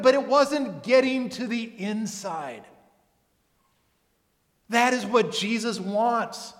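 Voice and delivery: urgent preacher driving the point home; impassioned preaching. Real, not acted. Preaches at full force.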